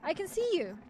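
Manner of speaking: high, projected call. A narrator's reading. Voice behind the door breaks the silence, calling out high to the narrator. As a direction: hail someone from afar.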